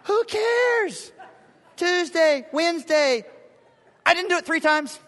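loud high exasperation; exasperated. Real, not acted. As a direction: exclaim it in exasperation. Preacher dismisses nitpick.

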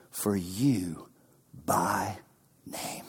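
unvoiced hush on for you by name; whisper. Jesus telling Peter softly, whispered. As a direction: whisper it tenderly.

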